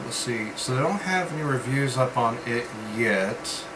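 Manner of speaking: usual speaking voice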